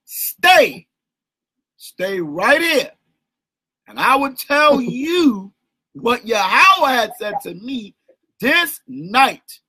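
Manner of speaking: very slow, drawn-out scripture reading; slow clear reading. Shown as a demonstration. Teacher showing the brother how to read the verse, very slow and clear. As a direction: stretch each word out slowly for clarity. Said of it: "read it a little clearer".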